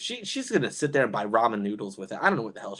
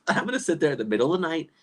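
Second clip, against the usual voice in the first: normal talking voice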